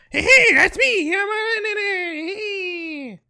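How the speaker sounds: extreme squeaky voice. Retold or imitated. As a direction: squeal it out as a silly extreme take. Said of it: "too many extremes".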